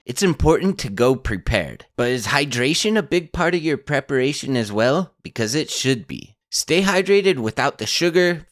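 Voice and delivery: scripted podcast sponsor read. Acted. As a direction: sell it smoothly with lively, rehearsed emphasis. Host reads second sponsor ad.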